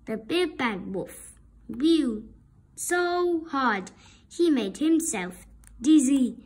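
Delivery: ordinary delivery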